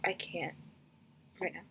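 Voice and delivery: soft whisper of giving up; whispered despair. Retold or imitated. Telling viewers the dialogue broke her, whispered. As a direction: breathe it out, defeated. Exasperated aside, reacting to the dialogue.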